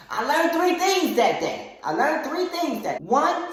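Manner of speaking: very high, loud declaration; loud announcement. Real, not acted. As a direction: proclaim the moral at full voice. Announcing the lessons at full voice.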